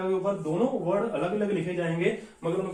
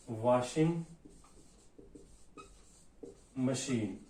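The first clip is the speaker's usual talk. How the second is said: slow dictation; 6 semitones lower, 9 dB quieter, much slower than usual. Shown as a demonstration. Teacher dictates examples.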